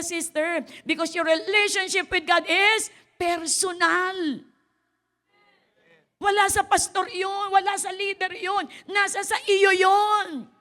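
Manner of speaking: loud, high preaching. Acted. Pastor preaching to the congregation, loud and high. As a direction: preach it with full force.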